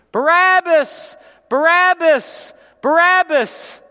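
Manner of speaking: high, loud, slow chanted scream; crowd screaming. Acted. The crowd calls for Barabbas, screaming chant. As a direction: scream the name like a mob. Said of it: "the crowd starts screaming".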